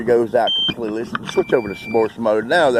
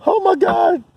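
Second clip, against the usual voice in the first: high, louder oh my God; startled relief; relieved exclaim; 4 dB louder than usual. Real, not acted. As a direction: burst out in relief at the landing. Drone finally landing.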